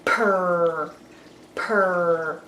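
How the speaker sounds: low purred purr; cat purr sound. Shown as a demonstration. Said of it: "they make a sound that sounds like a cat".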